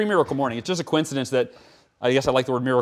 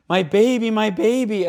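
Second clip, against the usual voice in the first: higher, louder, imitating a panicked mother; retold mother's scream; 7 semitones higher, 4 dB louder than usual. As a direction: re-enact Mom's cry of alarm, held back from full scream. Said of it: "I heard my mom screaming".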